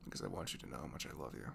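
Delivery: usual speaking voice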